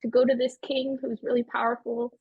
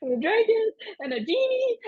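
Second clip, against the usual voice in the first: distressed babbling; 13 semitones higher than usual. Acted. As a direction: pour out half-sense words in distress. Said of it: "she's just in distress, and she's just saying nonsense words".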